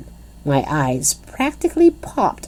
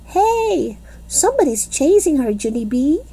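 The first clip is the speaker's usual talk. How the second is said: put-on high, fast, alarmed little-girl yell; 10 semitones higher than usual. A narrator's reading. Grace yelling to Junie B that someone chases Lucille, alarmed. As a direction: sound the alarm to your friend. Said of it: "she yelled".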